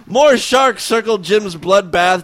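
ordinary delivery

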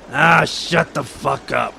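slow, surly growl in a put-on voice; barking old grouch. A narrator's reading. Grover cutting off the tour guide, barking. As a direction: bark it slowly, fed up. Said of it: "Grover barked".

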